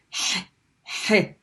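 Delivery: h, he as a strong breathy throat h; Arabic ha sound. Shown as a demonstration. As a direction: push air from the throat on h, he. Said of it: "ha has kasra".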